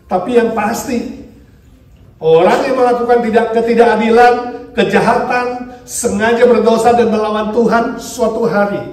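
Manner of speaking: loud, high sermon delivery; preaching at full force. Acted. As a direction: preach it out over the congregation. (berkhotbah dengan lantang)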